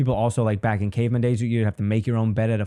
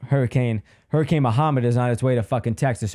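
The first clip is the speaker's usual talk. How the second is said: normal talking voice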